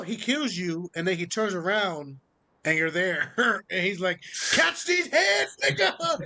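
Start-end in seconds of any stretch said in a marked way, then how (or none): 4.5-6.2 high, drawn-out fighting taunt; hammed-up attack yell; mock battle cry. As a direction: call it out high and slow like a playful challenge. Acting out an attack in retelling.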